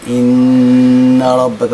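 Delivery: إن with the ن held nasally for two beats; held nasal ghunna. Shown as a demonstration. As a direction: lengthen the ن in إن. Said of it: "نقف عندها بالغنة مقدار حركتين". (غنة مقدار حركتين)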